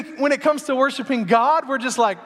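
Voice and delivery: ordinary talk, nothing marked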